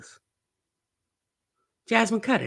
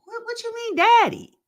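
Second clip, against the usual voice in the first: put-on high whiny little-kid voice; be the child who only wants his Elmo; toddler voice; 4 dB louder than usual. Commentator voicing the toddler wanting his Elmo, high childish voice. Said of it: "looking like".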